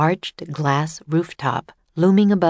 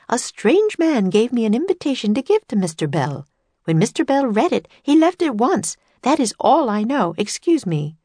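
higher-pitched, emphatic woman's voice; brisk helper with no more to tell; busy exhibit woman; 7 semitones higher than usual. A narrator's reading. Woman at the exhibit answering children's questions, higher emphatic voice.

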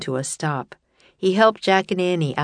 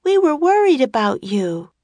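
put-on high, louder worried girl; 10 semitones higher, 5 dB louder than usual. A narrator's reading. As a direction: voice Annie with worry. Annie voiced by narrator.

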